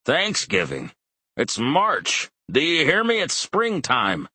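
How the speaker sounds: put-on loud, exasperated old man shouting; yelling father. A narrator's reading. Old father to his wife, yelling in frustration. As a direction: shout it so she hears.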